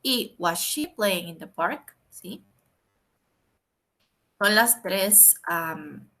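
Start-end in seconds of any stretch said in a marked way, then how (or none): 0.4-2.0 slow, clear question form with a small rise; slow model question. Shown as a demonstration. Teacher modelling the question form, slow and clear. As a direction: read the question out slowly for learners. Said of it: "Ahora vamos con la pregunta".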